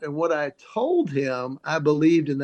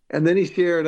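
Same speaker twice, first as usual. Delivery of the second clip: usual speaking voice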